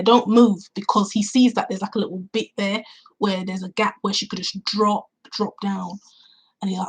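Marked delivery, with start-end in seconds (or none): none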